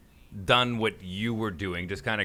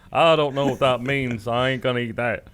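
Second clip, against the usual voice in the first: a light Texan twang, louder and slow; King of the Hill drawl; 4 dB louder than usual. Pretend. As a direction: play a puzzled Texan reading a box. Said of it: "I sound like I'm from King of the Hill now".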